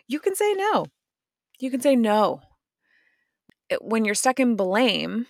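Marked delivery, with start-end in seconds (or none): 0.1-0.8 high sing-song. Acted. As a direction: say it way up high, lilting. Repeated line, first take.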